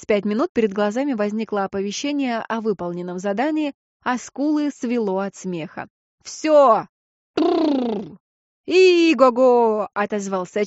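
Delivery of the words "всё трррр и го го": loud, whinnying horse play. Acted. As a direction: rein in like a horse, loudly. Playing a horse stopping.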